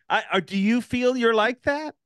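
ordinary talk, nothing marked

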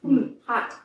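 talking around hot brownie; mouth full of hot food. Real, not acted. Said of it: "My mouth is on fire".

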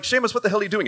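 exasperated parent. Retold or imitated. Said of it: "they're like".

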